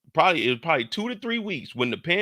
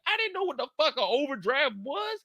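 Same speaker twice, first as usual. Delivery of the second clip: normal talking voice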